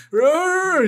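high strained zombie roar. Retold or imitated. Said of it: "es una zombie".

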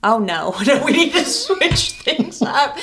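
mock dismay through laughter; half-laughed "oh no" reaction; laughing retelling. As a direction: crack up while quoting yourselves. Laughing while retelling their reaction.

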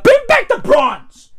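high, exclaimed demand; exasperated, high-pitched plea. Acted. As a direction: pitch it way up like a protest cry. Mock demand to British Museum.

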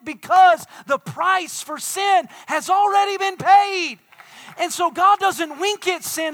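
loud, high, urgent preacher's proclamation; full-force preaching. Real, not acted. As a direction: preach it hard, building to a shout.

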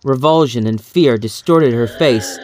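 ordinary delivery

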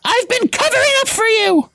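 put-on high girl's voice, shrieking; Hermione shrieking. A narrator's reading. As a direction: shriek the denial as a hurt schoolgirl. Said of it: "Hermione shrieked".

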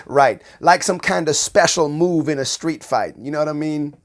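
ordinary delivery

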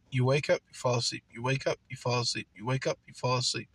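low, soft, monotone repetition; droning routine. Acted. Describing life's endless routine, soft low drone. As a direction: murmur it like an endless loop.

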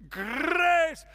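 high, loud Scottish "grace"; Scottish or Irish accent. Acted. Preacher exults in the word grace, Scottish brogue. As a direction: roar the word in a rolling brogue. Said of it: "can't say it except for in Scottish or Irish".

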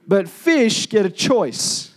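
usual speaking voice

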